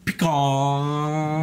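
pecan with a very long held "aah"; drawn-out vowel. Shown as a demonstration. Showing friends why it takes so long, drawn-out pecan. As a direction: stretch the end of pecan way out, "pee-kaaahn". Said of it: "it takes so long".

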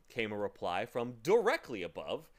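plain reading voice

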